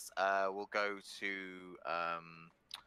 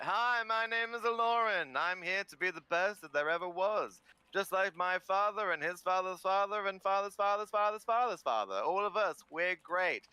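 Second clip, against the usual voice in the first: brash, loud bragging into the mic; boastful contestant; 7 semitones higher, 4 dB louder than usual. Acted. Cocky contestant grabbing the mic, pompous and loud. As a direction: boast about your bloodline, louder and higher.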